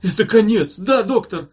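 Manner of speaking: loud, high anguished question; panicked patient begging an answer; croaked despair. A narrator's reading. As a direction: gasp out that all is lost. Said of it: "Пациент захрипел".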